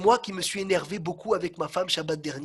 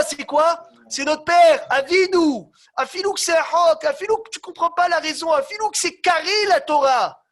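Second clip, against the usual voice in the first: high, loud, driving sermon voice; fervent preaching; 7 semitones higher, 8 dB louder than usual. Acted. Rabbi exhorting his listeners about Hachem, loud and fervent. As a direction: rouse the congregation with fire.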